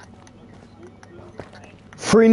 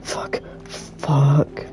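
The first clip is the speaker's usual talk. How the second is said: whisper; 7 dB quieter than usual. Real, not acted. Hiding quietly after chase.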